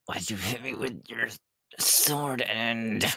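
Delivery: high, hurt complaint; indignant whine. Real, not acted. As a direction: whine about being hit. Just got hit with sword.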